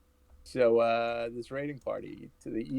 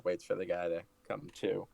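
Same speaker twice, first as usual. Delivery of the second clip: ordinary talk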